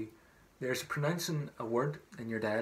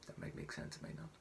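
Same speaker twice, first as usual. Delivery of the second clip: whispered aside; 14 dB quieter, much faster than usual. Real, not acted. Aside after a singing tip.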